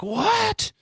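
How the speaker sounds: a dazed viewer suddenly sold on the brand; mock surprise. Viewer hearing the Pepsi plug, squeaky mock delight. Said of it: "and you're like".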